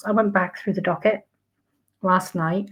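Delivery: ordinary delivery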